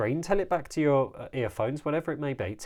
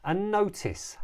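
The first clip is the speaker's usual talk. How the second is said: ordinary delivery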